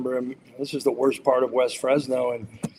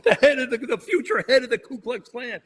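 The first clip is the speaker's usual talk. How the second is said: higher, laughing through the words; laughing disbelief; 7 semitones higher than usual. Real, not acted. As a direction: laugh in disbelief while saying it.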